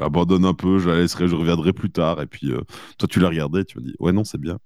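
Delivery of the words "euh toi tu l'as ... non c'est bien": ordinary delivery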